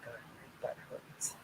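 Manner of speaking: whispered, slow, under her breath; a real hushed complaint of pain to herself. Real, not acted. Sore after surgery, she whispers the complaint to herself.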